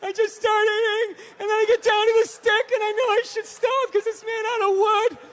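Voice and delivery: high, loud whiny confession; mock weepy dieter. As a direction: whimper out the sad snack story. Mocking a crying reality-show guest.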